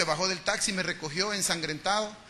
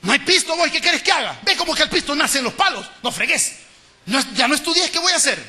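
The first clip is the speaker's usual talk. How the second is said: put-on higher, louder exasperated scolding of the kids; a father snapping that there's no money; harsh angry parent; 7 semitones higher, 6 dB louder than usual. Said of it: "¿Qué tal estos dos escenarios?".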